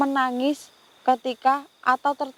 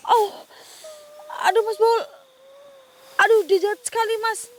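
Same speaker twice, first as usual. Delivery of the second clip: pained cry; 8 semitones higher, 3 dB louder than usual. Real, not acted. Shocked touching the cursed doll.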